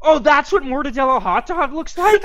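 high and aghast; can't believe what you're seeing; stunned and disgusted; horrified disbelief. Real, not acted. Reacting to the gross photo.

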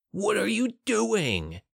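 high, drawn-out shocked question; horrified cry. A narrator's reading. Guard watches comrade kill his own men, horrified cry.

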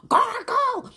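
sudden high shout of a bossy grandmother; grandma's shouted order. Retold or imitated. Grandma yells at her grandkid to gargle, high sharp shout. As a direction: bark the order at the choking child.

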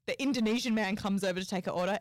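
normal talking voice

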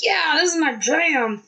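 high, slow mock partier voice; lightly mock a drunk fan; partier loving song. Woman mimicking neighbor grooving to Piano Man, mock. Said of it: "who's like".

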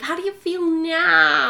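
high, slow, loud mock fascination; nosy gushing friend. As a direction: drag out the eager question. Funny friend's nosy questions acted.

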